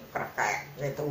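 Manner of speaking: ordinary delivery, nothing marked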